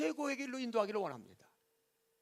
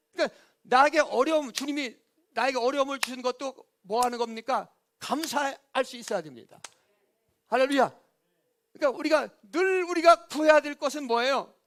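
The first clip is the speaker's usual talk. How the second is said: loud, raised preaching voice; full-force preaching; 5 semitones higher, 10 dB louder than usual. Real, not acted. Preacher urging thanks for hardship, full-force preaching. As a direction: drive the point home to the congregation.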